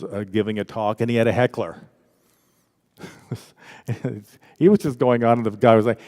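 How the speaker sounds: normal talking voice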